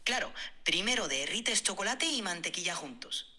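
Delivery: switches into Spanish, reading a recipe; speaking Spanish. Shown as a demonstration. AI asked for a Spanish accent, answers in Spanish. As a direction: answers in Spanish, not French. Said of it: "avec l'accent espagnol".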